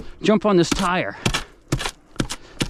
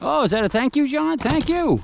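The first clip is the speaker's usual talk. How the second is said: high coaxing voice; 10 semitones higher than usual. Acted. Talking sweetly to the rooster.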